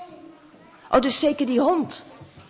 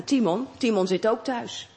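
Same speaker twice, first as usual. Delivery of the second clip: usual speaking voice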